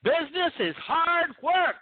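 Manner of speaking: high, slow and drawn out; emphatic advice. Shown as a demonstration. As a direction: hammer the point home, slowly. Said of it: "let me give you a little bit of business advice".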